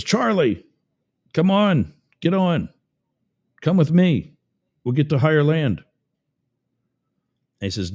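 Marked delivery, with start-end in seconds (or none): 0.0-5.8 high, raised call to a neighbour. Acted. Neighbour in a boat urging a stranded man aboard, calling out. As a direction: call across the water, pitched well up.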